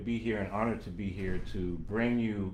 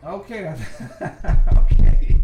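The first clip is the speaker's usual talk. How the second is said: laughing; 9 semitones higher, 25 dB louder than usual. Real, not acted. Laughs as stream starts working.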